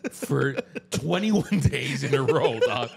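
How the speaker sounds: normal talking voice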